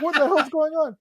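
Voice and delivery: high exasperated cry; mock exasperation. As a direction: yelp it baffled. Director ranting.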